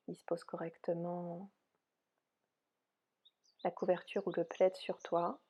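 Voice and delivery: soft, unhurried yoga nidra guidance; softly spoken relaxation voice, spacious phrasing; guided meditation. Real, not acted. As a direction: guide them gently into rest, with long pauses.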